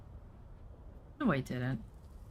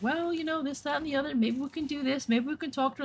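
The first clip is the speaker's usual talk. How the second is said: higher, softer, overly sweet placating voice; mock sugar-coating; 7 semitones higher, 6 dB quieter than usual. Mocking her earlier self placating the client, higher and softer. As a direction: mimic your own people-pleasing self, sweet and soft. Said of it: "I was sugar-coating it".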